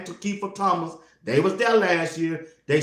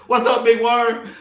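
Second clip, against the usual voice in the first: loud greeting; 8 semitones higher, 6 dB louder than usual. Retold or imitated. Calling out movie line.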